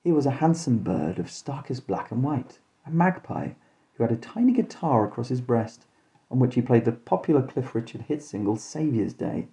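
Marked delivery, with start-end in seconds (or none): none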